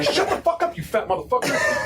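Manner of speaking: high, loud comic yell; high-pitched angry shout, imitated; retold yelling. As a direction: yell back at a teammate, acted out. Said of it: "I start yelling at Trey".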